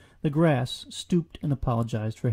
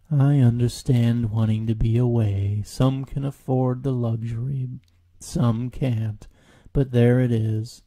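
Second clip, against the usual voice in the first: gloomy Eeyore; 6 dB louder, slower than usual. Acted. Eeyore on wanting to be away, slow and resigned. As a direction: let each word sag.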